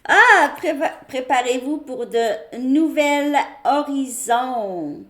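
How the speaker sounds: loud high burst, then slow announcement; excited. Real, not acted. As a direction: exclaim as the card reveals itself.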